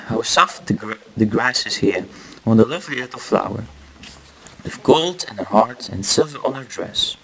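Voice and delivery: usual speaking voice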